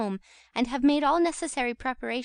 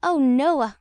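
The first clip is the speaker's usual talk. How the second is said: louder reproachful Charlotte; reproachful cry; 3 semitones higher, 8 dB louder than usual. A narrator's reading. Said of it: "cried Charlotte reproachfully".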